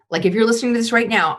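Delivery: ordinary talk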